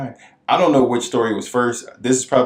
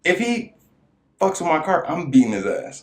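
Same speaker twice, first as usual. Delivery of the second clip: sharp pitched-up threat; angry threat; 7 semitones higher than usual. Retold or imitated. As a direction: snap it out like you mean it. Angry threat about his car.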